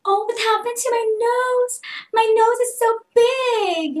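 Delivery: high, loud hammed-up shock; mock shock. Shown as a demonstration. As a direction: shriek in fake horror at the filter. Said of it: "Like that". (OA na gulat)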